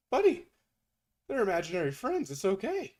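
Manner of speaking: high, loud mock comfort to a stranger; over-the-top soothing, pitched high; mock consoling. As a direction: coo at him like a crying child. Consoling crying video man.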